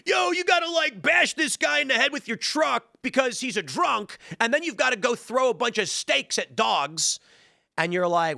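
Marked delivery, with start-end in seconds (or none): 0.1-7.2 much higher, hyped skater-dude voice; Bam Margera impression. Game character giving crazy tasks, high hyped dude voice. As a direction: pitch it way up like a rowdy pro skater barking dares. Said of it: "Bam Margera being like".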